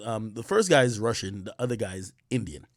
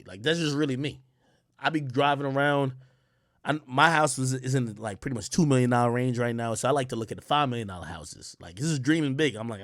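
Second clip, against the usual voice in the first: usual speaking voice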